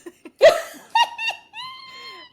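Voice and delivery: very high, loud burst of laughter; real laughing shriek. Real, not acted.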